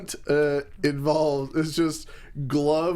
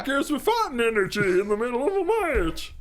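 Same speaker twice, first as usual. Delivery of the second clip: high, fast squeaky imitation; high squeaky Ricochet voice; 9 semitones higher, much faster than usual. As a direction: rattle it off, squeaky and cartoonish. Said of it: "that's exactly how he sounds".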